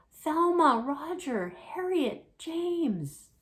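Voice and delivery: high, loud calling across the barn. Acted. Alexander calling his brothers and sisters, high and loud. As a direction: call them each by name.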